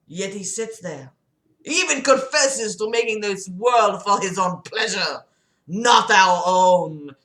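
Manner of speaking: put-on emphatic French-accented preaching. Patient arguing God allows sin, emphatic French accent. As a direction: hammer the key words in a thick French accent. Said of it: "that was totally French".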